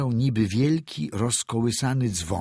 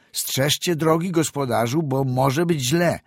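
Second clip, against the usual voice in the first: louder, higher, anxious call to the driver; urgent warning; 7 semitones higher, 5 dB louder than usual. A narrator's reading. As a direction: call out a warning over the wind. Doctor warns the driver.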